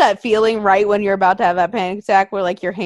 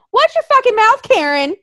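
much higher, loud angry snap; snapping at Karen; 12 semitones higher, 4 dB louder than usual. Retold or imitated. Telling off the laughing stranger, angry snap. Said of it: "not even thinking".

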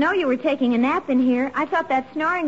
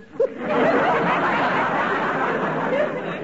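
giggle; 12 semitones higher than usual. Acted. [name] laughs at her own joke.

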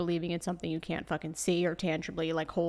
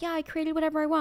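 put-on spoiled rich kid; 7 semitones higher, faster than usual. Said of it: "they're like".

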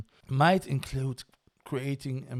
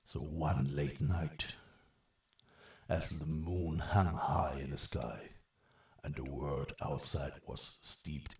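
storyteller voice; 4 semitones lower than usual. Acted. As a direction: tell it like a fireside tale. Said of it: "a storyteller voice".

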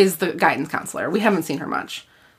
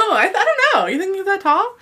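pitched up in amused disbelief; laughing surprise; 11 semitones higher, faster than usual. Real, not acted. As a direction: react as if that's absurd, through a laugh.

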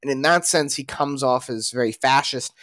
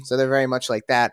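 usual speaking voice

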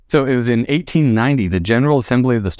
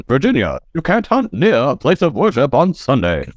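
put-on old-time newscaster read; 1950s radio voice, higher; Mid-Atlantic accent; 4 semitones higher than usual. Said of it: "I'll read the next law".

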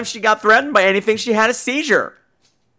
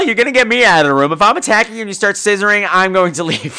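usual speaking voice

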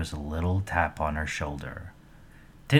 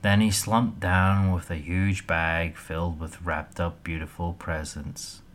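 ordinary delivery, nothing marked

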